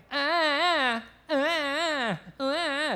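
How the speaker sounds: put-on half-hearted baby cry, not a real one; imitated little cry. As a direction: mimic a baby's attention-seeking fussing. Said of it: "little crying like".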